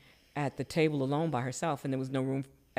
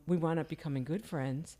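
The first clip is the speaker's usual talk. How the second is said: ordinary talk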